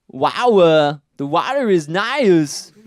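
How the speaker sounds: loud, high, slow Austrian strongman voice; hammed-up Schwarzenegger accent, slow and loud; Arnold Schwarzenegger impression. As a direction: boom it out like an action hero in the tub. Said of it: "That's the best impression that I have".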